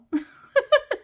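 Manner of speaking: sudden high giggle; high burst of laughter. Real, not acted. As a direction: break into a squeaky laugh. Laughing at own impression.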